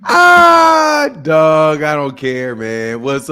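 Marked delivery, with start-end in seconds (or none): 0.0-1.1 high loud cry of Ah; a startled shout out of nowhere; sudden yell. Real, not acted. Streamer suddenly yelling at something on screen.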